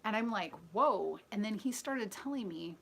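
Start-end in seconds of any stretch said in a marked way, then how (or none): 0.7-1.2 surprised whoa. Retold or imitated. Her reaction in story.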